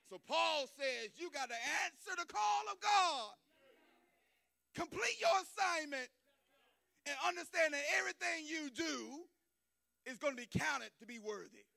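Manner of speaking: whooping preacher at full volume; full-force preaching. Acted. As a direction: preach it all the way up to the rafters.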